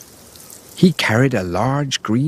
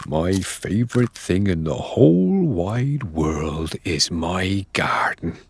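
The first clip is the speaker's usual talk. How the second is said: Mister Johnson musing; 5 semitones lower than usual. A narrator's reading. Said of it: "He thought to himself".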